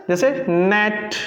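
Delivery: नेट slow and careful; drawn-out नेट with a short e. Shown as a demonstration. Teacher repeating नेट slowly for the class. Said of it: "है ना friends?".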